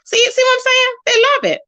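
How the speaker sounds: squealed high, teasing the haters; high gloating. Real, not acted. To her haters, a high gloating squeal.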